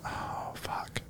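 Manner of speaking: hushed whispered curse. Pretend. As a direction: whisper it in mock fear. Reacting in a scared whisper.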